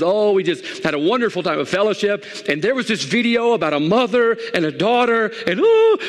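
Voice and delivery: high, swooning retelling that climbs to a squealed "ooh"; imitated gushing wife. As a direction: play the wife getting carried away, pitched high. Voices wife gushing about the video.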